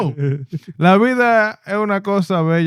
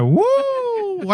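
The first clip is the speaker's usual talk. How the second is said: excited whoop; 13 semitones higher, 4 dB quieter than usual. Retold or imitated. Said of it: "you were like".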